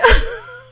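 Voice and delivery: short squeal of laughter; high laugh. Real, not acted. Laughter burst.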